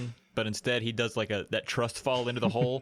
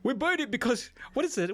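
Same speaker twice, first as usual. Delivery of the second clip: higher, mock Viking islander; a hammed-up Viking accent, higher voice; weird Viking accent; 12 semitones higher than usual. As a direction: play an annoyed Polynesian in a strange Viking accent. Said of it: "weird Viking kind of accent".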